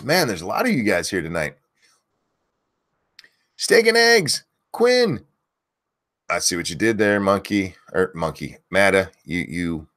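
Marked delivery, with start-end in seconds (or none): 3.6-5.2 yelling names. Real, not acted. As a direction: belt each viewer's name out. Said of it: "if you want me to yell your name".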